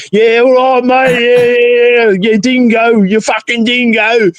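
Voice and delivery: exaggerated Australian accent, stretching the last words; mock Australian accent. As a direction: taunt with a broad fake Aussie accent. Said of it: "an actual Aussie talking about people's accents".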